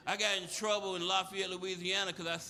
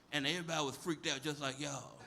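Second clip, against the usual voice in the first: ordinary talk, nothing marked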